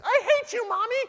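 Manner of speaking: put-on very high child voice; two-year-old. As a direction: pout like a tiny kid. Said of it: "little two-year-old saying".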